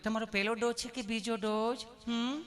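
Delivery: put-on sweet nurse; loving nurse. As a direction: ask with exaggerated tenderness. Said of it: "પ્રેમથી પૂછો છો".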